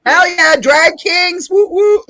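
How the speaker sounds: loud, high whooping hype; rally the crowd with a whoop; excited cheering. Real, not acted. Cheering drag kings.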